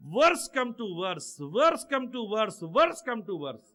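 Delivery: very slow, drawn-out repeats; slow emphatic repetition. Real, not acted. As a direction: stretch each word out slowly. (بہت آہستہ، کھینچ کر دہرانا)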